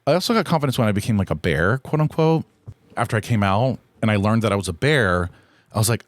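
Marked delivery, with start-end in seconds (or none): none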